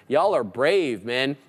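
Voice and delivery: usual speaking voice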